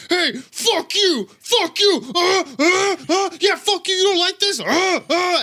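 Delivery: high, strained shouting with grunts; hammed-up furious outburst; mock enraged yelling. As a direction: holler like a crazed man picking a fight. Retold angry flasher yelling at car.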